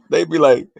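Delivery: ordinary talk, nothing marked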